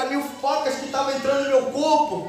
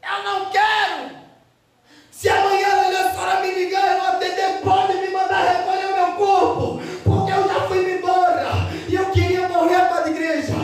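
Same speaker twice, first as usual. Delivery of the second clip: shouted despair in full preaching force; 5 semitones higher than usual. Real, not acted. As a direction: cry it out to the church. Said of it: "eu comecei a gritar".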